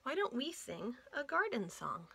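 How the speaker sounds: usual speaking voice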